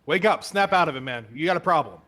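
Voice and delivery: scolding. Acted. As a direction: snap him awake with a harsh rebuke. Inspector scolding the captain.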